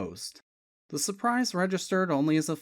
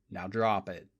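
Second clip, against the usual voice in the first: low, cold final order; chilling command; icy; 8 semitones lower than usual. A narrator's reading. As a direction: let it go cold and hard. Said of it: "his voice turned icy".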